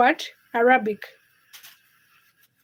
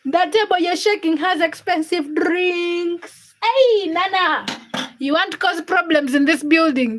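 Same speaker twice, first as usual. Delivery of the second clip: slow, higher put-on threat voice; mock warning; 4 semitones higher than usual. Streamer jokingly warns a viewer in chat, mock menacing. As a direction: tease a viewer with a hammed-up threat.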